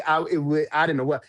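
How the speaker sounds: normal talking voice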